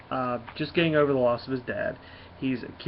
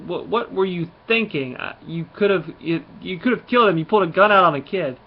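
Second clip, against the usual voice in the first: acted scolding; 5 semitones higher, 6 dB louder than usual. Gamora scolds Rocket over gun.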